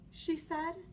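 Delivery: usual speaking voice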